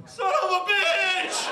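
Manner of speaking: high, slow yelled curse; pained yell. Acted. Burglar stepping on toys in the dark, pained high yell.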